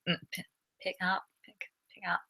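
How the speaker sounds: very soft, halting self-rehearsal; thinking out an accent. Real, not acted. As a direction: murmur it to yourself, testing the accent. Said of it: "think of the accent in my head".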